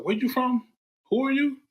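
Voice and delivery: high-pitched mock questioning of a newcomer; who's-this-guy questions, pitched high; imitated curious questioning. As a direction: quote puzzled strangers, voice jumping way up. Onlookers' questions about an unknown player.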